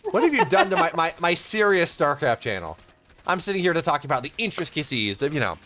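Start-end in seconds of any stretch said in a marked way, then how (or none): 0.1-2.8 louder playful indignation; mock exasperation. Caster teases co-caster for ruining his serious channel, mock exasperation. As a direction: pretend outrage at the co-caster.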